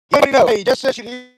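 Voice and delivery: choppy robotic glitchy voice. Real, not acted. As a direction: voice breaking up like a bad digital line. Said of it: "'cause you sound robotic".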